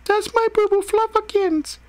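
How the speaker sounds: very high, squeaky put-on child voice; little sister crying out. A narrator's reading. Ghostly sister protests for her pet rabbit, high child's cry. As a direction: cry out in a tiny falsetto. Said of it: "Ethan's ghostly sister cried out".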